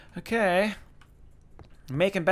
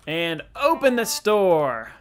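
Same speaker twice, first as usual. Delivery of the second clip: excited; 4 semitones higher, 8 dB louder, slower than usual. Real, not acted. Excited shopping-game plan.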